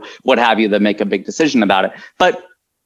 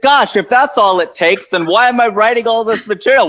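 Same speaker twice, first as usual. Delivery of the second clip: higher-pitched mock exasperation, retold; Tig Notaro; 9 semitones higher than usual. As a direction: act out a comedian's baffled complaint in a raised pitch. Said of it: "And she's like".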